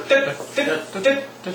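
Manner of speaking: very short high duts; shorter staccato. Shown as a demonstration. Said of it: "you can do it shorter".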